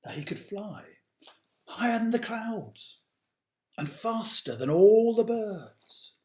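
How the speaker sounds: put-on slow, dramatic read-aloud for children; storybook narration. A narrator's reading. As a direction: tell it like a bedtime tale, big and grand.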